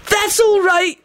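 very high, eager pipe-up; hopeful. A narrator's reading. Captive Benji reassures the gang, high and hopeful. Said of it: "voice rang out hopefully".

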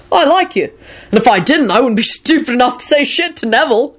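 high, declaring to the room; announcing. Acted. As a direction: announce it to everyone. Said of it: "he announced to the group".

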